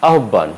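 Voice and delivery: আহ্বান as "ahobban"; hard "hobb" in আহ্বান; common mispronunciation. Shown as a demonstration. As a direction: double the b in আহ্বান. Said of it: "আমরা বলে থাকি".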